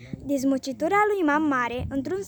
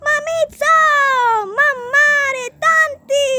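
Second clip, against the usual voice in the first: much higher and louder, screaming for family; a terrified boy yelling for help; child bawling; 12 semitones higher, 12 dB louder, much slower than usual. A narrator's reading. Goe screams for his mother, grandma and aunt, howling. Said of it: "începe să zbiere".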